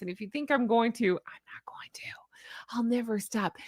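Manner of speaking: secretive whisper. Pretend. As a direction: whisper it like a confession. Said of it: "unvoiced whisper".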